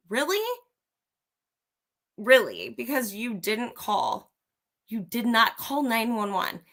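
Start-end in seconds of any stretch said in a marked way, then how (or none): 0.1-0.4 Really? pitched high and rising in disbelief; incredulous rising Really?. Real, not acted. To commenters pitying Dylan, rising disbelieving Really?. 2.2-2.7 flat falling Really?. Real, not acted. Repeating to commenters, flat falling Really?.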